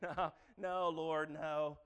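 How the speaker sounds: loud, high, stubborn refusal; Peter refusing. Retold or imitated. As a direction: dig in and refuse again. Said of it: "Peter's like".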